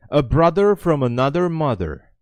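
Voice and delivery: loud, high, playful hail; exuberant call-out. Real, not acted. Streamer hailing a viewer as a brother, exuberant. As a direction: belt it out to a fellow countryman.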